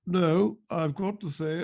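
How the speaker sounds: usual speaking voice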